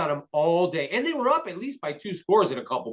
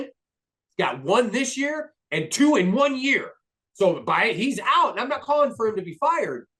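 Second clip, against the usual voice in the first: higher, fired-up fan venting; worked-up rant; 7 semitones higher than usual. Real, not acted. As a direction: let the frustration climb in pitch. Fan ranting about his team.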